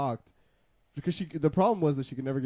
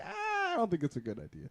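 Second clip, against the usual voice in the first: mock hesitant reply; 8 semitones higher, 4 dB quieter than usual. Retelling his own hesitant reply.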